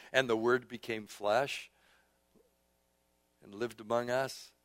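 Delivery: low, soft, reverent delivery; subdued pulpit meditation; hushed scripture reading. Real, not acted. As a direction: read it quietly, letting phrases sit between pauses.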